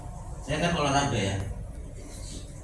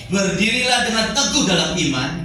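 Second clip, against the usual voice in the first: measured, declaimed Bible verse; scripture reading; 4 semitones higher than usual. Acted. Preacher reading Corinthians to the congregation, declaimed scripture.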